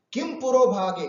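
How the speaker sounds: put-on a furious sage scolding the girl; angry rebuke. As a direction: hurl it at her in anger. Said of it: "बहुत जबरदस्त तेज आवाज में बोलते हैं".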